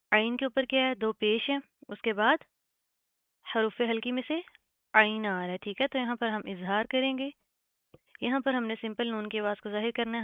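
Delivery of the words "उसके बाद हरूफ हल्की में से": ordinary delivery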